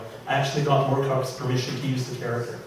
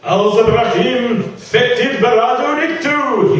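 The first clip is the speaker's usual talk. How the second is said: much higher, louder, slow frenzied chant; shouted incantation; 10 semitones higher, 9 dB louder, slower than usual. Acted. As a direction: bellow the spell as the ritual builds. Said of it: "he shouted".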